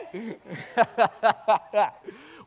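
loud, high laughter; hearty run of high laughs; real laugh. Real, not acted. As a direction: break into a long laugh. Laughs after asking for amen.

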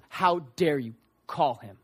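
low, slow, stern reproach; retold rebuke. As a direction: scold deliberately, word by word. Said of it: "it was a rebuke to the people".

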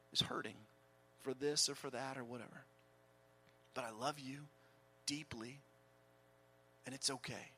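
soft, low, hushed comfort; tender. Acted. Jesus comforts a hurting churchgoer, hushed and tender. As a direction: ease it out gently, like soothing a hurt child.

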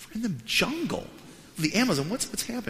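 high-pitched baffled protest; incredulous. Retold or imitated. Pastor hears of a celebrity in the jungle, incredulous. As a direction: squeak it out in disbelief.

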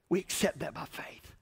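whispered softly. Real, not acted. Pastor whispering the key point to the congregation. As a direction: lean in and whisper it. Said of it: "preacher drops to whisper".

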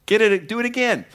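high, loud order; commanding call. Acted. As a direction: call it out to the men. Elijah ordering more water.

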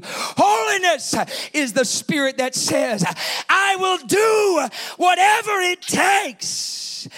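high, slow, stretched declaration; full-force preacher's cry; preaching shout. Real, not acted. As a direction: build to a loud, drawn-out vow.

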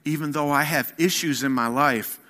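rousing sermon delivery; full-force preaching. Acted. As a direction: build it like a pulpit crescendo.

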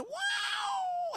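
very high, sustained grinder whine; imitated machine whine. Preacher imitating his dad's grinding machine, high whine. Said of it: "it was whining".

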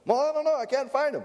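put-on high helpless kid whine; whiny child. Child whining to parent about missing shoes. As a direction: whine like a child who won't look. Child voiced in shoe dialogue.

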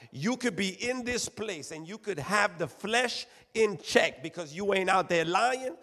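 high, driving sermon cadence; full-force preaching. Real, not acted. Preacher telling the church what they ain't doing, forceful preaching.

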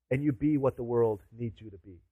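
normal talking voice

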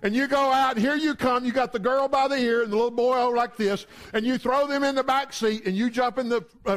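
ordinary delivery

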